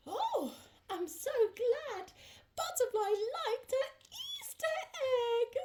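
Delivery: ordinary delivery, nothing marked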